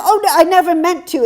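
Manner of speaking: very high, loud, flustered British apology; gushing apology. Retold or imitated. Young woman apologizing, very high and loud. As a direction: squeal out the apology. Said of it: "she said".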